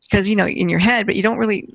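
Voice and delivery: usual speaking voice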